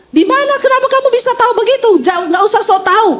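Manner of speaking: high, very fast mock indignation; acted flustered retort, rushed and high; defensive husband. Caught husband denies, acted quarrel.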